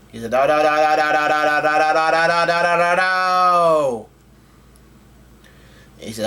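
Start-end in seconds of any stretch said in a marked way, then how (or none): 0.3-4.1 rapid-fire stammered no's, climbing higher; acted frantic denial. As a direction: run the no's into one long rising wail. Acts out character's frantic denial.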